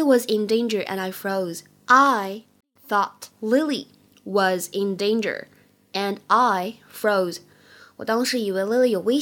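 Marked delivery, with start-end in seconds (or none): 1.9-7.4 very slow, phrase by phrase; slow model reading. Shown as a demonstration. English teacher models the line for learners, slow model reading. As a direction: draw each word out, pausing between chunks.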